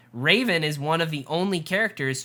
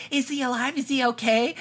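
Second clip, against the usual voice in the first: high-pitched anxious questions, imitated; mock worried; 10 semitones higher than usual. Podcaster imitating Clark fretting over Russell, mock worried. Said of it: "Why are you so worried?".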